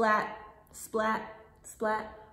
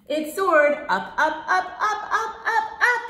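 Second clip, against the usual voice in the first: climbing higher and louder on each up; rising excitement; 7 semitones higher, 7 dB louder, faster than usual. Acted. Teacher making the kite soar up for kids. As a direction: lift your voice with the kite.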